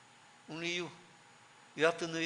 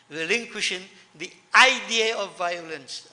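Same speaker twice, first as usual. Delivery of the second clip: idea drawn out, louder and slow; relinquishing the idea, with idea stretched and weighted; stress on idea; 7 dB louder than usual. Real, not acted. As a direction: lean hard on the word idea. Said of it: "And I'm emphasizing idea".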